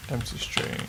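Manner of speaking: usual speaking voice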